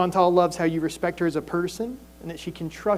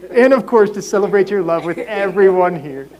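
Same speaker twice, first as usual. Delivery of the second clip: laughing; 9 dB louder than usual. Real, not acted. Laughing after joke at wedding.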